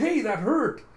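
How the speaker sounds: high, loud indignant yelp; mock-wounded outcry; acted hurt protest. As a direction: complain as if just punched. Character protests after being hit.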